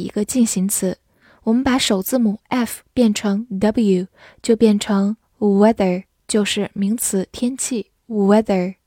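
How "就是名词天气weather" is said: ordinary talk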